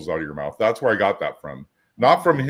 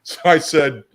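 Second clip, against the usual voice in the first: laughing; 6 semitones higher, 6 dB louder, slower than usual. Real, not acted. Laughing after delusional joke.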